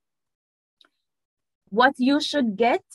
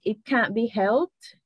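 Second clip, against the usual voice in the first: It can't be helped said slow and deliberate; slow English; slower than usual. Shown as a demonstration. Translating shogunai into English, slow. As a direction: spell out It can't be helped slowly. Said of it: "English shogunai would be like".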